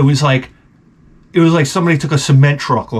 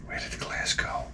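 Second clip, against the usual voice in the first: hushed unvoiced whisper; muttered whisper while hunting glass; 18 dB quieter than usual. Real, not acted. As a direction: whisper it to yourself while searching. Searching for broken glass.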